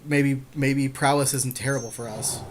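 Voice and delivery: usual speaking voice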